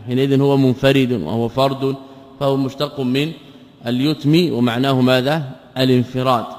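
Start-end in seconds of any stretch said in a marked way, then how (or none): none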